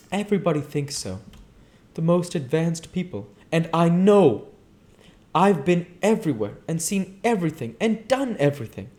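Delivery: a light, breathy-free high Daisy. Acted. Daisy continues, defiant.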